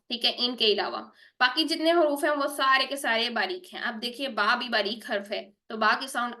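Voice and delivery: ordinary talk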